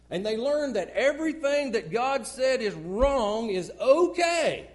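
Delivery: loud rising sermon cadence, high and hammering; preaching at full force. Real, not acted. Preacher warning the congregation, loud full-force preaching.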